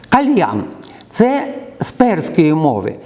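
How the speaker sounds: usual speaking voice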